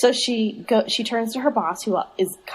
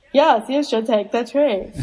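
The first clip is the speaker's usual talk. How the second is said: slow, stilted mock line reading; stilted aunt impression; bad-actress aunt; 3 semitones higher, slower than usual. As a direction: imitate a bad actress, drawn out. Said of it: "as bad of an actor as she is".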